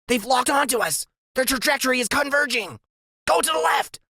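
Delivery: high, urgent genius voice; Freak's high voice. A narrator's reading. Sidekick warning his friend of the closing gang. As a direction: rattle off the danger in a high voice.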